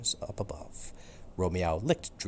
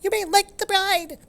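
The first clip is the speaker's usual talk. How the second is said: very high, louder squeaky pronouncement; squeaky mouse priest; 9 dB louder than usual. Acted. Monsignor Mouse pronouncing cat and dog married, squeaky and loud. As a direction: proclaim it like a tiny squeaking mouse.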